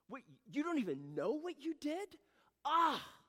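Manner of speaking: high, loud mock outrage; imitating angry wife. Wife fuming at clueless husband, mock outrage. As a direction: explode in exasperation, hammed-up. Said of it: "it made her more angry".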